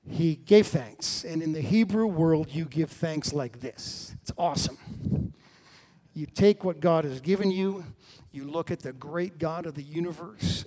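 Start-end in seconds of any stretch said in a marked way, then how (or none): none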